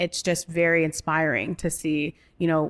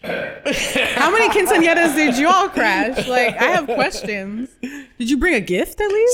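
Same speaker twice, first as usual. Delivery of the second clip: incredulous; 7 semitones higher, 5 dB louder, slower than usual. Pretend. Mock outrage at crashing parties.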